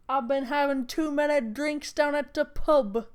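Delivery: lightly done British accent, half-hearted; a casual pub-talk British accent. Shown as a demonstration. Joking about sounding drunk, in a lazy British accent. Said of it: "My British accent is better than this".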